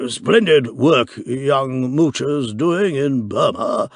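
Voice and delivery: slow, lightly voiced pompous chairman; a hint of an old clubman holding forth; slow club chairman, lightly done; chairman's voice. A narrator's reading. Chairman speaks at banquet.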